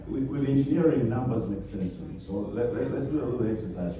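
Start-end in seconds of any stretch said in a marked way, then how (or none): none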